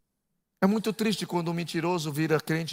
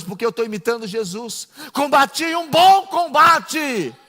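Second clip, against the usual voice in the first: fiery preaching; 5 semitones higher, 5 dB louder than usual. Acted. Preacher rousing the congregation, loud and high. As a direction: preach it at full force.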